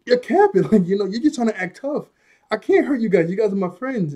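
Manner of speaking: a hurt friend insisting he can't do it; pleading Monomura. Retold or imitated. Retelling Monomura begging his friends, high and pleading. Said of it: "Monomura's like".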